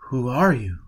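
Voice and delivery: ordinary delivery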